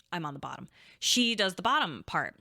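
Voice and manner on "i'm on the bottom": a soft muttered repeat; quiet aside. Real, not acted. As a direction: lower and much softer, under the breath. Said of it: "so then I'm on the bottom".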